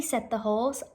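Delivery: ordinary delivery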